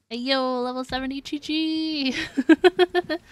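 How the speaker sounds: loud, slow, drawn-out cheer; excited cheer. Real, not acted. Streamer celebrating her maxed character to chat, excited drawn-out cheer. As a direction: shout it out like a victory.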